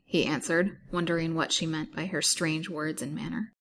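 ordinary delivery, nothing marked

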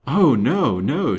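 usual speaking voice

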